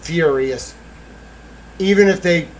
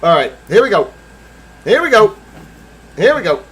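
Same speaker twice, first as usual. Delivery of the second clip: psyched up; 7 semitones higher, 8 dB louder than usual. Real, not acted. Psyching himself up.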